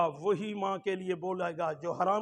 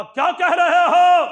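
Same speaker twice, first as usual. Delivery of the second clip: higher, louder bold retort; confident retort; 10 semitones higher, 16 dB louder than usual. Retold or imitated. Mother answering the village elders, bold loud retort. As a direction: fire it back with total confidence. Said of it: "ماں نے بڑے confidence سے کہا".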